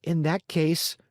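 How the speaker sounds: proud. Acted. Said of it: "said Pinocchio proudly".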